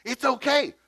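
very high, exaggerated reassurance; demonstrated enthusiasm. Preacher coaching his translator, high exaggerated enthusiasm. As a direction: show the translator how enthusiastic it should sound. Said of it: "Say it like I said it".

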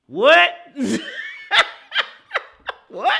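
loud, high "What?"; incredulous shout. Real, not acted. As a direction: yell it in disbelief. Shocked by rushing stats.

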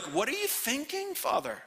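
doubting angels. Acted. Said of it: "the angels of God are like".

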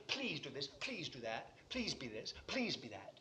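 low, soft, repetitive mock prayer; timid pleading. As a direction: list the pleas meekly and quietly. Imitates endless timid pleading.

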